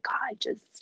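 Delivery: hushed, breathy prayer whisper. Retold or imitated. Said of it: "breathy voice we use when we pray".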